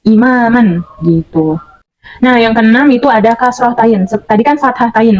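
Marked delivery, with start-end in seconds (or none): 0.1-0.9 "Imaman" said slowly, syllable by syllable; pelan, "Imaman" per suku kata; slow demo word. Shown as a demonstration. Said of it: "dibacanya man".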